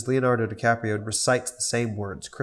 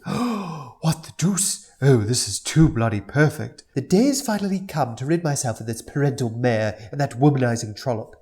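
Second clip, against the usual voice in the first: Stewie's British voice; 3 semitones higher, 5 dB louder than usual. Acted. Stewie voiced in read script.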